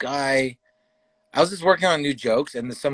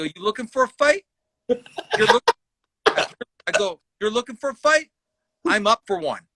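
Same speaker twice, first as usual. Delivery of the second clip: retold confrontational challenge; 8 semitones higher than usual. Retells squaring up to heckler.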